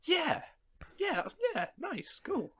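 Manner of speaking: a hint of a contented audience member; satisfied moviegoer. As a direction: imitate someone mildly approving as they leave. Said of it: "walk out of it and go".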